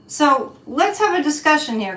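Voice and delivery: normal talking voice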